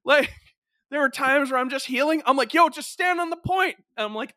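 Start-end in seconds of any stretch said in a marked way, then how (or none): 2.5-3.8 high-pitched, pleading game callout; urgent team callout. Retold or imitated. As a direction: call it out mid-firefight. Acted in-game plea to teammate.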